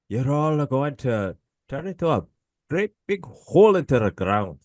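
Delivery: put-on high Scottish accent, casual threat. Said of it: "your Scottish accent".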